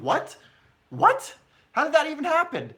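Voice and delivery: retold shock, a very high squeaky second "What?"; stunned disbelief. Streamer recalling waking up to the Fabinho signing, shocked. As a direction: replay the moment the signing news hit. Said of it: "and I was like".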